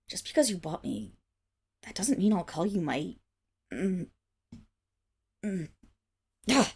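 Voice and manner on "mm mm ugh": usual speaking voice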